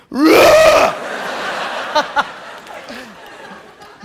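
loud mouth-made engine roar; car engine imitation. Shown as a demonstration. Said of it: "Araba sesi yapmak isteyen varsa". (araba sesi taklidi)

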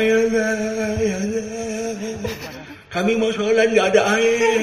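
slow, drawn-out weepy plea; hammed-up crying to God; mock tearful prayer. Imitating Arifin Ilham's weeping prayer, drawn-out mock sobbing. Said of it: "Dia doa".

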